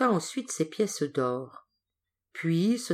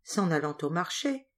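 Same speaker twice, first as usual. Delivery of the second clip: usual speaking voice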